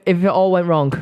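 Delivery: normal talking voice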